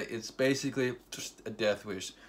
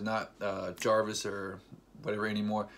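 normal talking voice

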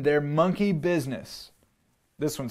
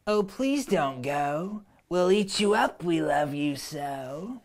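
pleading monsters; 3 semitones higher, slower than usual. Acted. Wild things begging Max to stay, slow pleading monster voice. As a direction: beg him not to go. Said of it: "But the wild things cried".

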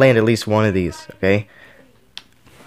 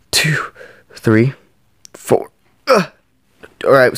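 ordinary talk, nothing marked

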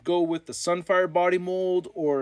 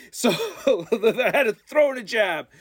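laughing; 5 semitones higher than usual. Real, not acted. Teasing jab at friend Robo.

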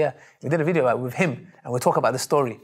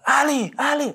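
calling Ali urgently, much higher; excited shout; 12 semitones higher than usual. Retold or imitated. Friend shouting to Ali about the convert, excited yell. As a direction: shout the name across the street. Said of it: "Why are you shouting?".

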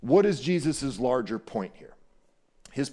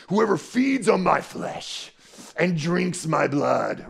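slow, much higher, drawn-out declaration; dramatic Jesus; 5 semitones higher, 3 dB louder, slower than usual. Retold or imitated. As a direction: stretch it out like a grand proclamation. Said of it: "this is how I picture Jesus saying this".

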